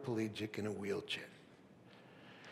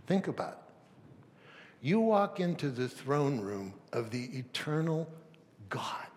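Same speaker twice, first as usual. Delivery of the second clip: normal talking voice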